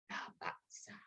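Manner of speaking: soft unvoiced whisper; hushed whisper of a prayer. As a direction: breathe the words like a gentle blessing. Nabi's prayer quoted softly.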